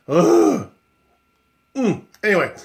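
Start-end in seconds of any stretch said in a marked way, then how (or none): 0.1-0.7 disgusted ugh. Acted. Disgusted groan after mocking fear.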